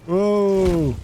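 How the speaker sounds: loud, high, drawn-out whoa; startled whoa. Real, not acted. Player reacting to a character's sudden death, startled. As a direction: let out a long, startled cry.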